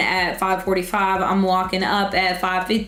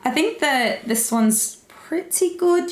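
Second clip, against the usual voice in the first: put-on British accent; 6 semitones higher, slower than usual. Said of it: "What's your favorite accent to do?".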